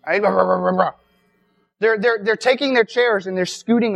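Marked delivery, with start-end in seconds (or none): 0.2-0.9 low, wordless grumbling noise; mock grumbling. Preacher imitating the Pharisees at the table, mock grumbling. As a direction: imitate a grumble in repeated nonsense syllables. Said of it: "they're grumbling".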